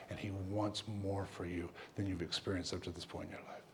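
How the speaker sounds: low, hushed, sincere assurance; tender, earnest. Retold or imitated. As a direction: softly tell them something deeply meant. Tenderly ministering to upset girl.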